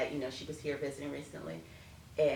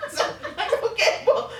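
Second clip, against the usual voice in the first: high, loud laugh winding down; trying to recover from laughing; 12 dB louder than usual. Real, not acted. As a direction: still giggling.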